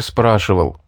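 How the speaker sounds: plain reading voice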